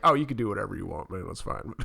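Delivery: low mumble. Retold or imitated. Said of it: "he's like".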